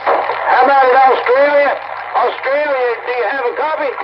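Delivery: calling out over the radio to Australia. Pretend. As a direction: project it all the way to Australia. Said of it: "talk a little louder".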